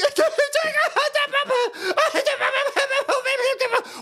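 high blubbering gibberish wail; mock crying. Host mimicking people crying about everything, mock bawling. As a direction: imitate a bawling crybaby with no words. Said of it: "crying about fucking everything".

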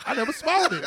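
laughing friend's retort. Retold or imitated. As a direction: laugh the line out.

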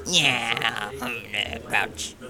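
a hint of a grumpy trash-can grouch; Oscar the Grouch voice. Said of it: "can you do Oscar the Grouch?".

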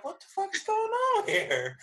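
high-pitched retold alarm; bewildered shock. Recovering patient sees work Slack blowing up, bewildered. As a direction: shoot up high in exaggerated disbelief.

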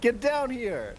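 in-character yelled challenge; yelling up. Acted. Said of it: "I yell up at her".

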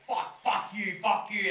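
loud angry yell at a passerby, high and harsh; shouted abuse. Retold or imitated. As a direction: bellow it in his face. Stranger yelling at him.

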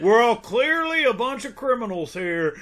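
high, loud, badly faked criminal swagger; putting it on. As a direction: overact trying to pass as a crook. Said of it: "he's putting it on a little bit".

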